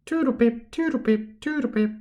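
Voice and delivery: high, repetitive robot voice saying "Toodle pip"; robotic headmistress. A narrator's reading. The broken robot professor repeats herself in a high robot voice. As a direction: chirp it like a broken posh robot. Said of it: "she kept saying over and over".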